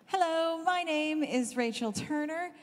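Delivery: ordinary delivery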